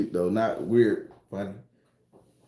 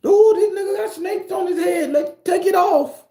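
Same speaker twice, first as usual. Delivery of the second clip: very high, loud mock alarm; acted horrified shouting; 8 dB louder than usual. Onlooker seeing snakes on his head, shouting in alarm. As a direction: shriek it like a startled onlooker.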